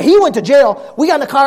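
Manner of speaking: ordinary delivery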